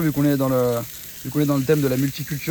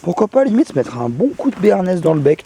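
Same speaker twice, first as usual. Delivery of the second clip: much higher, loud excited outburst; 5 semitones higher, 11 dB louder than usual. Real, not acted. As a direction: shout it with glee. Joking loud over the fire.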